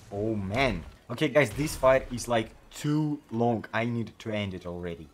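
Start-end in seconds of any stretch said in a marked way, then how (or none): none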